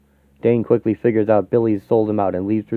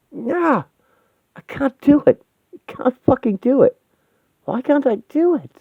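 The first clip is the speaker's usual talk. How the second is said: high, straining cries of despair; anguished protest. Acted. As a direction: wail in helpless frustration. Character fails at a struggle.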